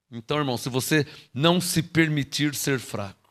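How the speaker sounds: usual speaking voice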